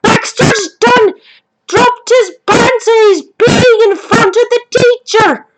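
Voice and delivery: put-on high little girl's voice, slow at first; imitating Annie reporting Baxter, high childlike voice; little girl tattling. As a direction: tattle like a shocked seven-year-old. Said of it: "she says".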